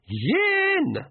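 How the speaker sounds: sharp high yelled count; pixie shout. A narrator's reading. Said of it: "shouted the Nac MacFeagle".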